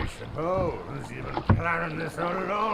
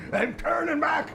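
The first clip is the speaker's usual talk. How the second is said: high, louder panicked declaration; 9 semitones higher, 4 dB louder than usual. Acted. Boatman refusing to go on, panicked. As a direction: snap into panic.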